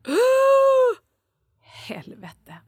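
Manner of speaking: high loud gasp then whispered curse; a sudden horrified epiphany; shocked realisation. Retold or imitated. [name] realising she is Monica, gasp then whispered curse. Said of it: "Jag bara".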